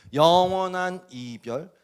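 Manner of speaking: slow, loud, weighty; drawn-out emphasis. Real, not acted. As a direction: stretch each syllable for gravity. Dramatic sermon climax.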